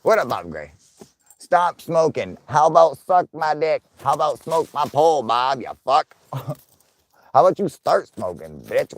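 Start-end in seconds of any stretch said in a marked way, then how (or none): 1.4-8.9 loud, higher rant at a viewer; mock angry yelling. Real, not acted. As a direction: yell back at him, half joking. Shouting back at a chat user.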